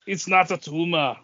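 a light Schwarzenegger impersonation; Arnold Schwarzenegger voice. Retold or imitated. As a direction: toss off the movie line as the action star. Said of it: "Arnold Schwarzenegger".

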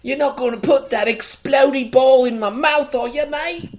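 mock British accent. Podcaster imitating the actor dreading a prop, mock British. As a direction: ham up a nervous Brit actor on set.

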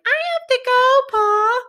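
put-on very high little-kid squeal; squeaky mock child voice, pleading; Carrie. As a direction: pipe up like a tiny child. Voicing small child Carrie.

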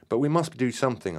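shouted. A narrator's reading. Narrator shouting at Terry to help friends, urgent shout. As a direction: cry out desperate to act. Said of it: "I shouted".

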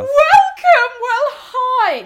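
high, loud, gleeful welcome cry; excited welcome. Real, not acted. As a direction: shriek a welcome to the listeners.

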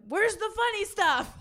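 high, slow, laughing mock French-Canadian voice; mock Québécois. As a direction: whine it like a bored Quebecer. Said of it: "people in Quebec are like".

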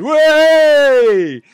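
loud, high, drawn-out whoop; long mocking "wey" from onlookers; jeering crowd cheer. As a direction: cheer like a laughing pub crowd. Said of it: "around are laughing now, going".

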